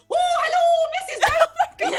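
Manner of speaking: very high, loud hammed-up greeting; Mrs. Doubtfire. Actor mocking what the writer didn't mean, hammed-up character voice. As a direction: trill it out in an over-the-top falsetto impression. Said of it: "He didn't mean, like, the".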